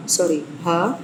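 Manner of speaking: usual speaking voice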